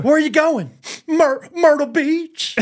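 high slow quoted dialogue; movie quote. Retold or imitated. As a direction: act out the scene.

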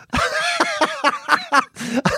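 high burst of laughter; helpless high-pitched laughing; real laugh. Real, not acted. Laughing at own anecdote.

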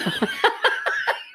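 a high, breathy squeal of laughter. Real, not acted. Friend squealing with laughter at rat-like hamsters.

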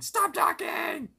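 high, loud, slow panicked yell; Rigby yelling. Retold or imitated. Rigby cutting Mordecai off, squealing yell. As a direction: blurt it out in a strangled panic. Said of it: "really close-up shot of him saying".

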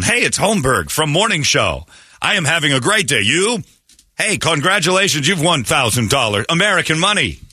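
high, put-on cloned-voice imitation; hammed-up prize call in a high voice; fake phone-call voice. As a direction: pitch it way up, over-eager fake caller. Mimicking AI voice on prank call.

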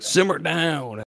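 slow, lightly voiced old sailor; a lightly voiced old sailor calming everyone down; gruff sailor catchphrase. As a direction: drag it out like a grumpy old salt. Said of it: "he'd always be like".

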